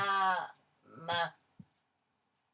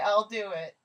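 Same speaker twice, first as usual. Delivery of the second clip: normal talking voice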